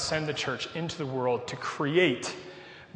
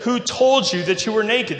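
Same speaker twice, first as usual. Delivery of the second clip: higher, louder voice of God questioning; God confronting; 6 semitones higher, 6 dB louder than usual. Acted. God questions Adam about being naked, loud confronting voice. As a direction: demand an answer as God. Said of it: "This is God speaking".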